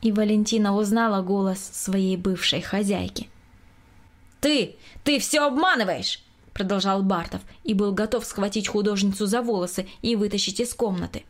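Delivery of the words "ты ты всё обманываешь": high, halting outburst; angry accusation. A narrator's reading. Bartov, ready to grab the painter, accuses her angrily. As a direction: stumble on the first word, then accuse sharply.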